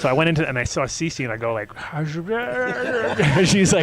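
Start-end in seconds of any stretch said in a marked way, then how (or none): 1.7-3.8 high laughing voice. Retold or imitated. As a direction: laugh through the quote. Laughing retelling.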